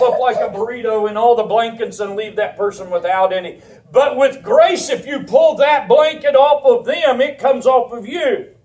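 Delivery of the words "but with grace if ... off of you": rising, louder, slowing preach up to a shout; preaching at full force. Acted. Pastor urging his mothers to mercy, building to a shout. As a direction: drive it home to the congregation, building.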